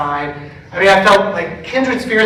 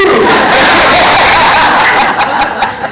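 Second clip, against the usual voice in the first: loud high laughter of real delight; 10 semitones higher, 10 dB louder than usual. Real, not acted. Pastor laughing with the congregation, loud and high. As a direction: laugh out at the happy coincidence.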